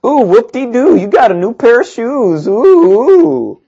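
high, sing-song fake admiration; sarcastic mock praise. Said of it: "It's like".

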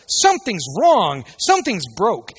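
loud, slow alarmed cry of a worried believer; mock panicked complaint. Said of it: "we're like".

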